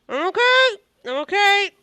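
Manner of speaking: high, loud yelps of reassurance; flustered, high-pitched pained babble; hurt but insisting. Acted. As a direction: squeak out that all is fine after a tumble. Character falls on face, insists he's fine.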